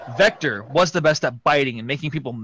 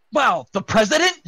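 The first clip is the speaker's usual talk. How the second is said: shouting; 9 semitones higher, 6 dB louder than usual. Acted. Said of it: "shouted Sonic".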